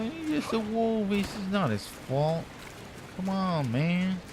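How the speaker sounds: high, drawn-out pleading protest; mock distress. Streamer protests killing a poor baby wolf, in mock distress.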